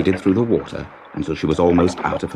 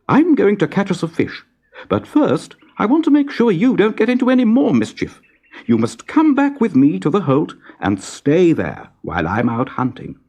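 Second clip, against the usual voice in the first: higher, firm mother otter voice; mother otter sets the rule, firm and higher; 11 semitones higher than usual. A narrator's reading. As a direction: lay down the rule to your cub. Mother otter gives orders.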